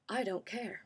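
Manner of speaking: hushed, dismissive aside; inner thought. Retold or imitated. Interviewer's private thought about the candidate, quiet dismissal. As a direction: say it under your breath, indifferent. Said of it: "But in her head, she would say".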